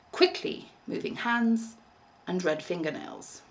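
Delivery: ordinary delivery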